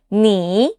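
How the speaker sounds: "Ni," dipping down, then coming back up; falling-rising "Ni,"; third tone. Shown as a demonstration. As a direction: dip the pitch in "Ni," then lift it. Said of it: "common words with the third tone".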